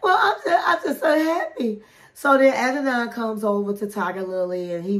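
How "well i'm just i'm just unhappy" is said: high-pitched whiny imitation of a tearful wife; mock crying. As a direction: act out a tearful protest, pitched way up. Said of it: "And she started crying".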